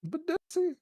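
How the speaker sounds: squeaky mock question. Mocking the made-up word.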